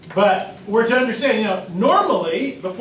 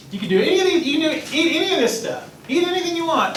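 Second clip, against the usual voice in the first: indulgent creator; 9 semitones higher, faster than usual. Retold or imitated. God's permission quoted to children.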